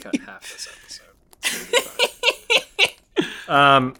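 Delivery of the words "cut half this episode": whispered aside to the editor. Real, not acted. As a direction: say it under your breath.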